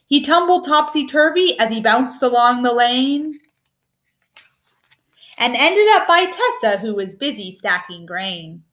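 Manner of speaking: ordinary delivery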